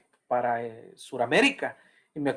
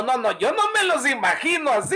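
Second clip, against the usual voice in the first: ordinary talk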